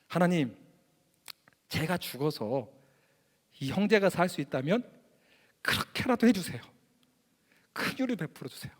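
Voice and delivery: 낮고 느리게, 목이 메듯 조용히; 마지막은 거의 속삭이듯 잦아드는 간청; 간절한 기도. Retold or imitated. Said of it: "진심이었어요". (형제를 위해 대신 죽겠다는 목사의 눈물 어린 기도)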